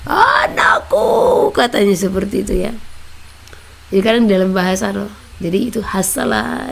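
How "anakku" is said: high, very drawn-out call of "Anakku"; God calling his child, long and high. Retold or imitated. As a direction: stretch "Anakku" high and slow like a divine call. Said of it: "dia langsung bersuara".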